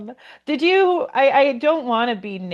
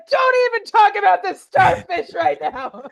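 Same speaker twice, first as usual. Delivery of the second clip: higher, laughing mock snap mid-fight; mock fight retort; 10 semitones higher, 4 dB louder than usual. Podcast host acting out a fight, laughing. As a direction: blurt it through a laugh. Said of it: "and then you're like".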